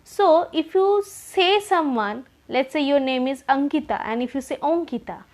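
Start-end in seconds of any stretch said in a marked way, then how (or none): none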